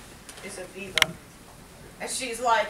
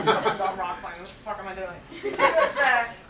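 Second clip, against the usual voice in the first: ordinary talk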